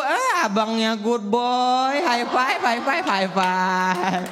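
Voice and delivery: high, gentle English-speaking rich dad; posh mall dad. Retold or imitated. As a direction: coo at the child like a rich Jakarta father. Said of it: "bahasa Inggris".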